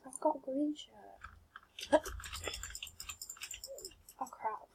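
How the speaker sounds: ordinary delivery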